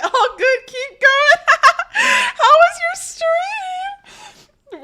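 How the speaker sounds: very high, loud squeal through laughs; real helpless laughter. Real, not acted. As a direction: crack up while trying to ask politely. Cracking up while greeting raiders.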